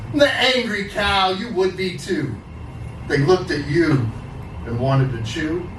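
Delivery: angry cow voice in a slam poem; slam poetry performance. Acted. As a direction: rage at them through the poem as the cow.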